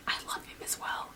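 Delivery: hushed, voiceless whisper; whispered confession. Real, not acted. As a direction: whisper it like a secret aside. Whispered aside confessing love for character.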